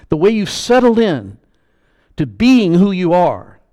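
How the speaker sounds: loud, high preaching voice. Acted. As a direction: preach it out with full force. Building preaching list.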